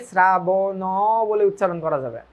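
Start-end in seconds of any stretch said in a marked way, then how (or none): 0.1-0.8 শ্রাবণ drawn out as "sra-bo-nooo"; wrong open ending. Shown as a demonstration. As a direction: stretch শ্রাবণ with a long final o. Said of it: "মানুষ ভাববে এটাকে". (শেষে স্বর টেনে "শ্রাবণো")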